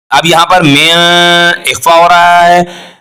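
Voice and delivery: usual speaking voice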